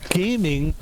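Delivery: ordinary talk, nothing marked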